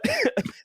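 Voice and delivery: very high, squealing laugh; high giggle. Real, not acted. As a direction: burst into a high-pitched giggle. Laughing at his own joke.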